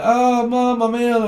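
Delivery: child calling for Mum, mock whine; mock sick child. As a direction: whinge like a poorly kid calling Mum. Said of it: "they're ringing the bell".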